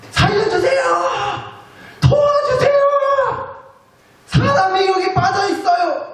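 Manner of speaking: high desperate cries for help; stuck in a pit, calling out to passersby, high yelling for help; crying for help. Pretend. Pit victim calling for rescue, high desperate yell. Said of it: "이렇게 소리 지르지 않겠어요?".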